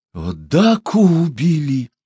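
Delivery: slow, high cry of despair; anguished, stretched exclamation. A narrator's reading. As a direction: cry out in shocked grief, drawn out. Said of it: "в отчаянии воскликнул Чумбока".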